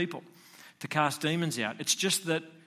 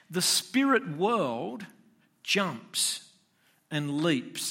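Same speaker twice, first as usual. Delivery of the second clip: slow dramatic; 3 semitones higher, much slower than usual. Real, not acted. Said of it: "preacher slows for dramatic effect".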